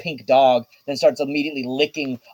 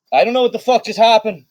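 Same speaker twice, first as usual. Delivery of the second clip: higher, louder, fast babble; flustered rant; 7 semitones higher, 3 dB louder, faster than usual. Acted. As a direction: spill out words in a frantic rush. Riz flustered after battle.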